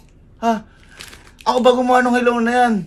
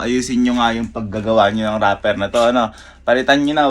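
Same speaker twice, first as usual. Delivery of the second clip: ordinary talk, nothing marked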